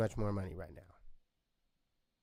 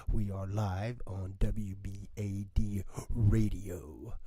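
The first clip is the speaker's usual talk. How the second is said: radio announcer; 3 semitones lower, 4 dB louder than usual. Acted. Radio show intro announcing.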